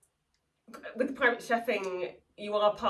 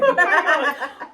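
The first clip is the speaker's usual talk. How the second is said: slow, high, loud laughing groan; 8 semitones higher, 12 dB louder, much slower than usual. Real, not acted. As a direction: groan through laughter at the memory. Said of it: "I was so wrecked".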